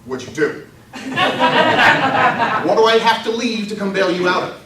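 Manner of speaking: mock exasperation breaking into laughs; dad laughing, teasing his daughter over bail; laughing disbelief. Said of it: "the other side of me is going".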